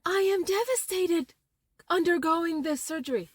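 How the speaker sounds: hammed-up sobbing voice; mock devastation. Shown as a demonstration. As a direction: overplay the misery as a parody. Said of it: "I thought something like this".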